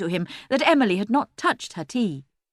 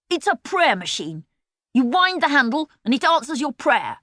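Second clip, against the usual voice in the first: rude; 7 semitones higher, 3 dB louder than usual. A narrator's reading. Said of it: "he said rudely".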